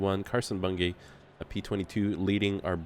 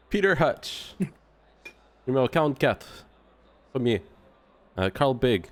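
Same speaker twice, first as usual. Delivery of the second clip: put-on French accent; 4 semitones higher than usual. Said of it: "Can you just say their names in a French accent?".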